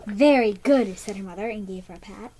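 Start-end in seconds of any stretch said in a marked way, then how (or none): 0.1-1.0 mother's praise. Acted.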